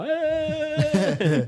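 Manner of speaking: put-on high excited kid shout. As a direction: shout like a kid on a vibrating plate. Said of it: "sit on it when they get there and go".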